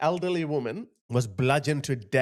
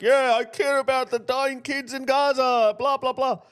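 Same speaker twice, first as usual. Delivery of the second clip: high, louder sneering virtue-signal voice; mocking the crying woman's imagined reply, high sneer; mocking impression; 11 semitones higher, 5 dB louder than usual. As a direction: ham up a self-righteous activist. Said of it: "She'd be like".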